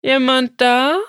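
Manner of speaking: high, loud, scared call into the silence; calling out fearfully, hoping someone answers; frightened call. Acted. Lost boy calls into the empty flower field, frightened and loud. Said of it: "rief er ängstlich".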